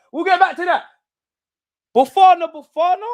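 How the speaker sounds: very high, loud excited cry; elated goal reaction. Real, not acted. Fan hailing the scorer just after the goal, excited. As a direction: hail the scorer at the top of your pitch.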